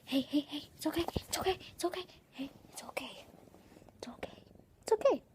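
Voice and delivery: high, hushed whispered comfort; calm a frightened pet gently; soothing the cat. Real, not acted.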